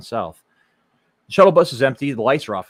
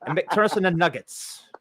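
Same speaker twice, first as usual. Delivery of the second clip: put-on Chicago accent; 7 semitones higher, 3 dB louder than usual. Jokey Chicago-accent riff continues.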